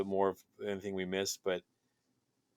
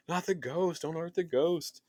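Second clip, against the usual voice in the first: pleading; 7 semitones higher than usual. Retold or imitated. Pleading with a character on screen.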